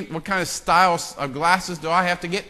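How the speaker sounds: usual speaking voice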